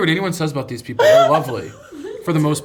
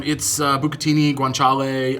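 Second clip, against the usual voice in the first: usual speaking voice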